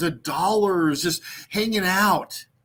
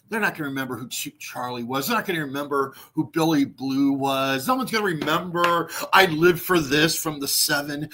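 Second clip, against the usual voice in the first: usual speaking voice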